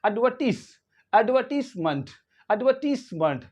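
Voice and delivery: एडवर्टीजमेंट as "ud-VUR-tiss-munt" with silent R; soft S. Shown as a demonstration. Teacher models British word, soft S and no R. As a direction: hiss a soft S, not Z, in एडवर्टीजमेंट. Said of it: "ये soft एस रहेगा".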